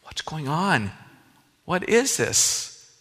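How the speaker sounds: ordinary talk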